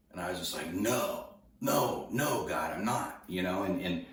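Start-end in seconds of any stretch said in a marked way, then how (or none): 0.7-3.2 retold protest, pitch jumping on the repeats; reenacted arguing, dropping off at the end; arguing with God. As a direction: plead a mild, drawn-out refusal. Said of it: "I'm arguing with God on this".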